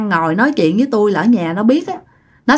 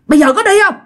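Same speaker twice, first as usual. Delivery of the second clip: sharp, high, loud scolding; fierce scolding shout; 10 semitones higher, 4 dB louder, much faster than usual. Retold or imitated. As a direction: bark it at her like an angry husband. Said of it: "ba nạt mẹ rất là dữ nha". (quát mắng giận dữ)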